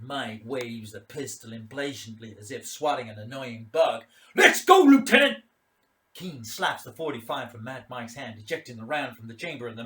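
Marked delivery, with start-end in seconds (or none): 4.3-5.4 loud, high snapped command; impatient bark. Acted. Mad Mike ordering the lieutenant to move, impatient bark. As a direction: hurry him along.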